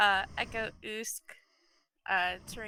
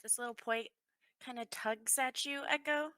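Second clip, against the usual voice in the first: ordinary talk, nothing marked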